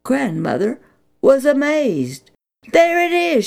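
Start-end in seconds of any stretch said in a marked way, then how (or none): 2.7-3.5 high, loud cry of discovery; excited cry. Acted. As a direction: point at it in amazed delight. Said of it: "she cried".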